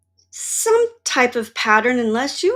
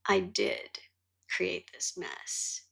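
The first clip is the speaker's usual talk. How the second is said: whispered, much softer admission; hushed realization; 6 dB quieter than usual. Retold or imitated. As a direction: let it sink in, barely voiced, slow at the end. Quiet admission of own fault.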